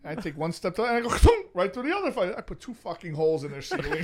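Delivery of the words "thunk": thunk. Retold or imitated. Sound effect of stepping through ceiling.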